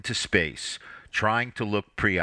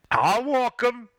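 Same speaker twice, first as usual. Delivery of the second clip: put-on much higher, louder small child; eager little boy; 14 semitones higher, 4 dB louder than usual. A narrator's reading. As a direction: promise it like a keen four-year-old. Four-year-old boy volunteers.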